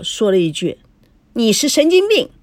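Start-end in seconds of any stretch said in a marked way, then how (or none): 1.3-2.3 high-pitched angry comeback; defiant retort. A narrator's reading. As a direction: hurl the insult back, not backing down. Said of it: "孙娜也不甘示弱".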